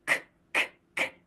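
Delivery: k, k breathed out; breathy English k; aspirated k. Shown as a demonstration. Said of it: "Can you feel the difference".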